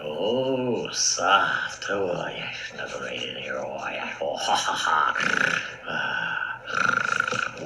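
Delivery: drowsy lion whispering to himself; sleepy lion settling down. Acted. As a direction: snuggle in, half asleep, whispered.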